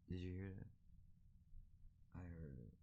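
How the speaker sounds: slow, hushed and wary; listen for the noise, barely voiced; hushed alarm. Real, not acted. Startled by a noise in the room.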